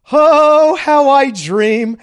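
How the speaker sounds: high, loud dreamy sigh; dreamy swoon. Acted. Host swooning over the raffle name, a high loud dreamy sigh.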